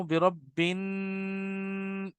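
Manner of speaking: slow hummed ending, held for one beat; measured ghunna. Shown as a demonstration. Teacher shows the right length of ghunna, one slow beat. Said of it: "ایک الف کے برابر ہو".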